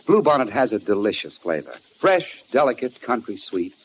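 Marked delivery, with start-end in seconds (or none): none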